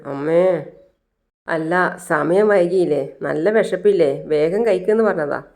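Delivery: usual speaking voice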